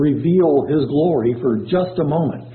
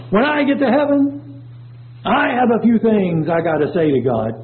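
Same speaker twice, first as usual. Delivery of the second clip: high, self-important mock boast, falling as it goes; haughty; 6 semitones higher than usual. Scoffer boasting about facing God in heaven, haughty. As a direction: put on a haughty, entitled voice. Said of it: "the haughty voice".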